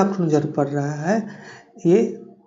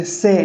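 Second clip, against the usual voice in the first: "से" given more stress than "कौन"; stronger stress. Shown as a demonstration. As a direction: lean firmly on the syllable "से". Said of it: "ज़्यादा stress से बोलेंगे". ("से" पर ज़्यादा ज़ोर देकर)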